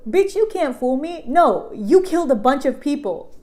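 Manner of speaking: loud, high-pitched acted scolding; mock outraged accusation. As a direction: call out the liar, loud and indignant.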